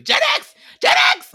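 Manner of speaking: very high, very loud re-enacted shriek. Viewer yelling a quiz answer at the TV, shrieking. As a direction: scream the wrong answer with full conviction. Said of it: "actually just shrieking at the T V".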